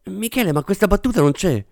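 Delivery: high, light, puzzled voice; querulous objection. A narrator's reading. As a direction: point out a script error timidly. Script supervisor objects to director. (obiezione perplessa, voce acuta)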